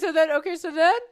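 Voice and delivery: high, loud burst to restart the story; excited restart. Real, not acted. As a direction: gather yourself and launch back in. Excited rush retelling chaos.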